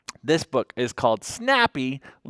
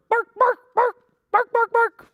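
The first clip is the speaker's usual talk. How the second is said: dog barking; 6 dB louder than usual. Acted. Dog barks in picture book.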